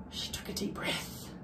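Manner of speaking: hushed whisper on the breath; breathless whisper before a big moment. A narrator's reading. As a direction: whisper it like holding your breath. Tense moment before speaking.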